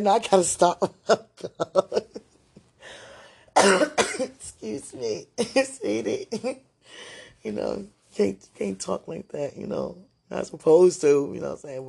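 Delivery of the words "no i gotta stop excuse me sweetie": high, loud, breaking into laughter; real giggly embarrassment; laughing. Real, not acted. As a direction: laugh through trying to stop yourself. Laughing at her own flirty talk.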